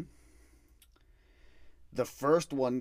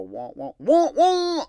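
mock wailing; 14 dB louder, slower than usual. Imitating a woman crying out.

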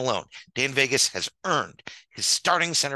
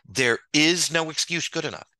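ordinary talk, nothing marked

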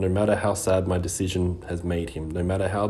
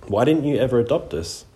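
tight, raised voice, higher and louder; tense question; 6 semitones higher, 6 dB louder than usual. A narrator's reading. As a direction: demand an answer he has long held in. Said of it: "His voice is suddenly tense".

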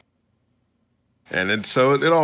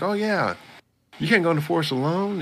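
higher, fast warning; worried master; 9 semitones higher, faster than usual. Retold or imitated. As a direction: rush to stop him, voice pitched up. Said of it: "He's like".

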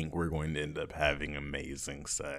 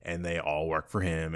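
ordinary talk, nothing marked

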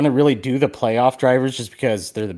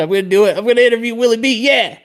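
higher and louder, building to giddy excitement; hyped up; 12 semitones higher, 6 dB louder, faster than usual. Retold or imitated. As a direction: psych yourself up to go for it. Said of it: "I'm like".

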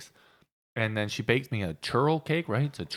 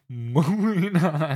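laughing moving on; 5 semitones higher, 4 dB louder, much slower than usual. Real, not acted. Laughing, closing the topic.

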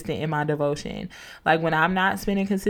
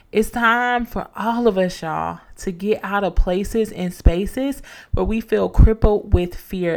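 usual speaking voice

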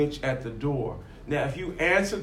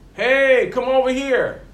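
higher, louder call from far off; 9 semitones higher, 6 dB louder than usual. Retold or imitated. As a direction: call someone over across the trees. A voice calling in forest.

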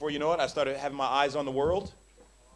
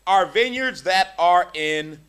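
scripture reading; 4 semitones higher, 9 dB louder, slower than usual. Acted. Reading scripture aloud in a sermon.

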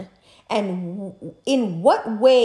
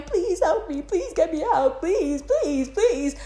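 higher, imitating a panicked caller begging; mock pleading cry; 7 semitones higher, slower than usual. Mocking the caller begging for help, higher pitched. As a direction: whine it out like a fake victim. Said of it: "screaming and crying".

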